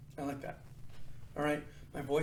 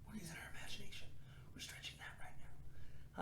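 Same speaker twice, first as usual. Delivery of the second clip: whisper; 2 semitones higher, 13 dB quieter than usual. Pretend. Whispered aside to kids.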